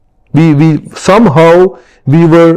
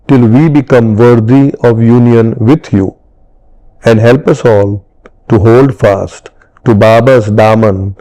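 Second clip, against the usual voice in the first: lower, measured prayer recitation; recited prayer; 4 semitones lower than usual. Acted. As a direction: recite it devoutly, phrase by phrase.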